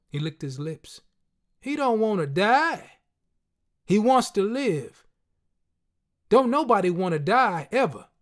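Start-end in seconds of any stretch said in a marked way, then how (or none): 1.6-8.1 friend's emphatic voice. A narrator's reading. Friend insisting to the narrator nobody wants to die.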